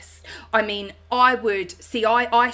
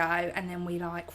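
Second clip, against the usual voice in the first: normal talking voice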